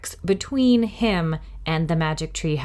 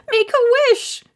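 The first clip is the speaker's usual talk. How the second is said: put-on high, louder frantic girl's scream; Annie screaming; 6 dB louder than usual. A narrator's reading. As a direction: shriek at him to hurry. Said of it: "cried Annie".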